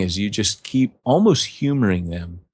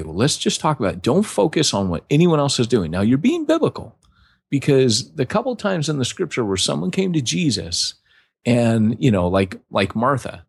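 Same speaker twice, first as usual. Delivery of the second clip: ordinary talk, nothing marked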